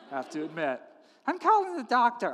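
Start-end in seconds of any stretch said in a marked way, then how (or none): 1.3-2.3 raised, worked-up pitch; insist, voice lifting; insistent wife. Acted. Wife overruling her husband, insistent.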